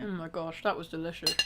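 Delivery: talking through a mouthful; words round a bite of steak; chewing while talking. Real, not acted. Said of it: "eating noises".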